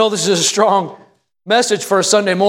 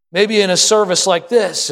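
ordinary delivery

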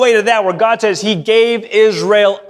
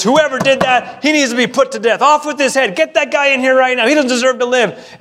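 indignant outburst, pitched higher; all worked up; 5 semitones higher than usual. Acted. David reacting to Nathan's story, all worked up. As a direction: act out a king's heated demand for punishment. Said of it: "David gets all worked up".

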